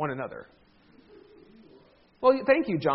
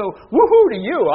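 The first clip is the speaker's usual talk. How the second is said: mock cheer; 5 semitones higher, 10 dB louder, slower than usual. Real, not acted. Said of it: "mock cheer to congregation".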